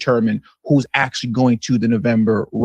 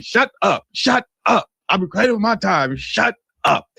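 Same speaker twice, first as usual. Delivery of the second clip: imitating a hostile congressman, pitched up, mild mock shout; mock angry yell; 6 semitones higher than usual. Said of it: "Just screaming in the hall of Congress".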